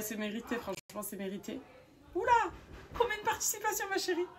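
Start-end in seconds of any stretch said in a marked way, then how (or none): 2.1-4.3 very high-pitched astonished question; amazed exclamation. Real, not acted. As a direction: shoot the pitch right up in disbelief. Amazed at the winner's many entries.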